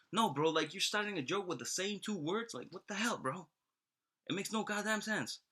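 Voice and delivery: high-pitched, incredulous complaint; exasperated protest. Acted. Man objecting to God repeating the joke, exasperated.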